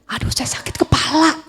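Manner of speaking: breathy whispered complaint of a headache, put on; faked sick whisper. Woman to her friend at church, feigning a headache in a whisper. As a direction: whisper it like you're pretending to be ill. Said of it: "saya bilangnya".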